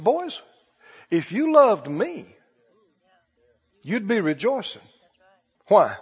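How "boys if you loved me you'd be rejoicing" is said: soft, slow tender reply; gentle Jesus. Retold or imitated. Jesus gently correcting his upset disciples, soft and slow. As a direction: say it kindly, slowly, like a patient teacher.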